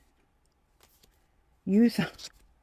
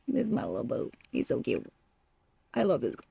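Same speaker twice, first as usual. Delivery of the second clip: cooing; 9 semitones lower, 8 dB quieter than usual. Real, not acted. Cooing over a pet.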